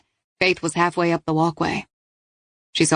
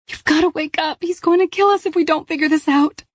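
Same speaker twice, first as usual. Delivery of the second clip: high urgent plea to wake her; young woman voice; 11 semitones higher than usual. Acted. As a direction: voice the trapped pregnant girl urging. Paige pleads, voiced by narrator.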